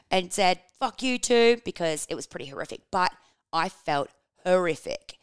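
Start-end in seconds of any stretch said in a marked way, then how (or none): 0.8-1.6 higher, a bit louder mock retort; half-hearted mock yell; retold mild yell. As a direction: replay the snap lightly. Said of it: "that I yelled at".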